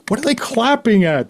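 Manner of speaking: high-pitched incredulous question; baffled disbelief. Retold or imitated. Newcomer baffled by the clapping, retold disbelief. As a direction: question it in mock bewilderment. Retelling his bafflement at applause.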